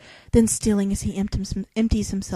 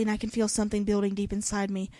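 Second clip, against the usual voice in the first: ordinary talk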